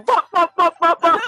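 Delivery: high, playful imitation of what people say; high mock bop bop chant; mock Jamaican bop. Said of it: "the first thing they do is go".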